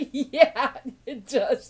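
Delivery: loud laughing agreement. Real, not acted. Laughs while answering.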